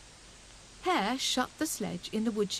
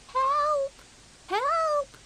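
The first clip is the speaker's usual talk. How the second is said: much higher, louder squeaky cry; tiny squeak; 6 dB louder than usual. A narrator's reading. Fuzzy Peg trapped in the snowball, squeaky cry. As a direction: squeak for help from inside the snow. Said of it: "piped Fuzzy Peg".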